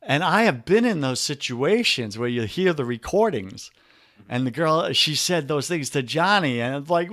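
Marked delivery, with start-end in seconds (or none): none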